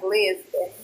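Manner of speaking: ordinary talk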